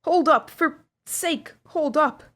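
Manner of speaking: high, slow bellow to stop; roared plea. A narrator's reading. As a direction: beg them to stop, yelling. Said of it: "roared out".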